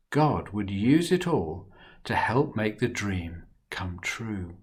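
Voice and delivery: lively, expressive storybook reading; reading to children. A narrator's reading. Storyteller reading Joseph's story to children, animated. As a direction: tell it to the little ones with big feeling.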